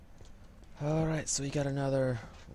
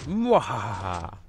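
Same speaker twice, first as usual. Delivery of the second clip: mad scientist; 7 dB louder, much slower than usual. Pretend. Triumphs over puzzle with evil laugh.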